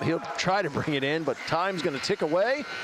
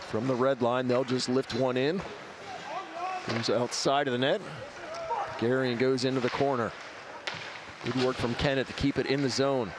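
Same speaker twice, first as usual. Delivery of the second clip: live hockey play-by-play; 2 semitones lower than usual. Acted. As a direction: call the action live. Play-by-play of game.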